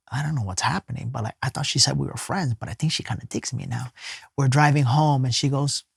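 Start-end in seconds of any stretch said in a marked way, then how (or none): 0.1-3.9 soft, quick, private musing under the breath; hushed inner thought. Retold or imitated. Lying beside her, puzzling to himself, softly and quickly. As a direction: think it fast to yourself.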